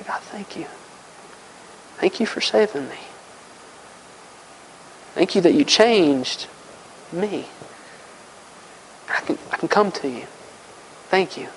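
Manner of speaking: hushed, breathy thanks to God, half whispered; soft, intimate whispered gratitude; whispered prayer. Shown as a demonstration. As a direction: pray it softly, barely voiced, like a private thank-you. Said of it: "And now I cry out to God".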